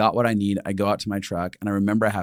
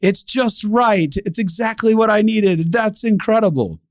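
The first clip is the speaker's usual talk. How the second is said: delighted acceptance; 10 semitones higher, 3 dB louder, slower than usual. Shown as a demonstration. Voicing a heart that receives.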